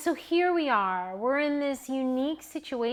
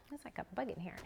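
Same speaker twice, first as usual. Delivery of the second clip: hushed whisper, no voice; 14 dB quieter, much faster than usual. Real, not acted. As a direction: whisper it so nobody hears. Repeats it whispering.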